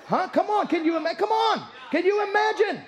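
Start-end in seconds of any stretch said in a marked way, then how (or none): none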